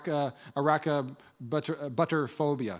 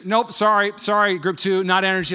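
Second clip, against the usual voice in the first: mock game-show rejection; 5 semitones higher, 10 dB louder than usual. Leader rejects the answer again.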